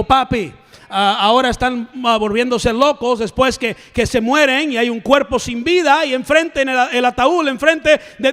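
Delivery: usual speaking voice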